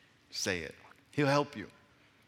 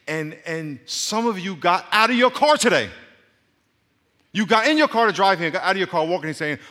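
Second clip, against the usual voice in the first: loud, high, urgent sermon challenge; raised, projecting preacher's voice; full-force preaching; 7 semitones higher, 9 dB louder than usual. Real, not acted. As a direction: drive the challenge home to the congregation.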